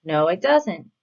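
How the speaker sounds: ordinary delivery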